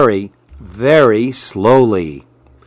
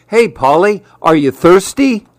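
high, bright calling voice; eager lizard call; 6 semitones higher than usual. Acted. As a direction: call out hoping to be seen.

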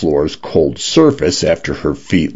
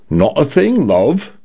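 lightly voiced male Cockney condo, pitched up; breezy Cockney home assistant; a hint of a Cockney male voice, relaxed and easy; Cockney-leaning British; 10 semitones higher than usual. A narrator's reading. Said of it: "in a male British voice that leaned toward a Cockney accent".

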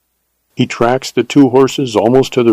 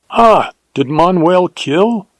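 higher, slowed surprised question; news catches him off guard, slow and high; exclaimed; 7 semitones higher, slower than usual. Acted. Bandit hears of the murder for the first time, surprised. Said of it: "exclaimed the other".

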